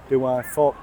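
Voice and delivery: ordinary delivery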